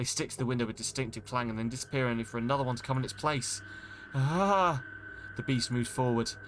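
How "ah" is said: sudden high yelp of fright; short frightened yelp; startled cry. Acted. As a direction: gasp out a scared cry. Monster lands on the car.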